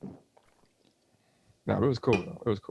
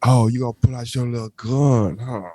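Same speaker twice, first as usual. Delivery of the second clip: put-on teasing funny-boy voice.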